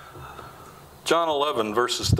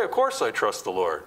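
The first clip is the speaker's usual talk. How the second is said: rattled off lower, without a thought; the easy head answer, lower and offhand; quick glib answer; 7 semitones lower than usual. Retold or imitated. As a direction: toss it back automatically, lower. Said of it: "You're gonna answer right away".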